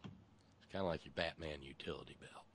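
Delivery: ordinary delivery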